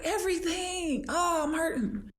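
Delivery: high, whining complaint; high-pitched whining client voice; whiny client. Retold or imitated. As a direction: wail it like a sore client. Clients complaining about workouts.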